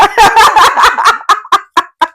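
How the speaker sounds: bright burst of laughter; embarrassed hearty laugh; loud laugh. Real, not acted. Laughing at own story.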